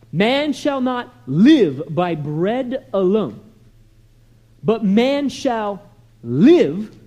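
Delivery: slow, weighty declamation with punched key words; measured, emphatic pulpit recitation; preacher reciting scripture. Acted. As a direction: declare the verse, pausing before each big word.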